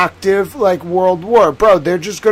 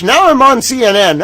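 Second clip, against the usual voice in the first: usual speaking voice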